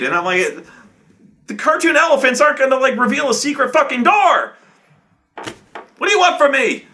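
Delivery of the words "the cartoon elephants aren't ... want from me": high-pitched, hammed-up exasperation; exasperated outburst. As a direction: re-enact pleading in exasperation, high. Game master arguing with players.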